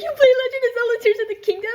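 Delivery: very high, fast squeal of a question; mock excited gasp. Dreamer learning partner plays Zelda, high fast excitement. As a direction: rush it out high, in exaggerated excitement.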